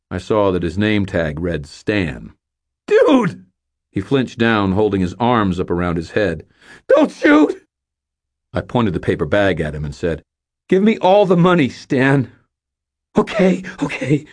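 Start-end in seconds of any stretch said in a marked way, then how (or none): 2.9-3.5 very high, loud cry; panicked yell. Acted. Panicking cashier yells at the robber, very high and loud. 6.9-7.7 very high, loud frightened cry; terrified plea. Acted. Frightened cashier begs the robber not to shoot. 10.7-12.3 high, loud demand; demanding robber. Acted. Young robber demands the money from Stan, high and loud. 13.2-14.3 nervous agreement. Acted. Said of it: "Stan said".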